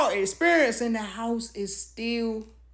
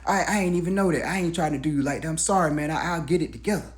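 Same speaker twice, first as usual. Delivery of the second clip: quick sheepish apology; 4 semitones lower, faster than usual. Retold or imitated. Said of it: "He said".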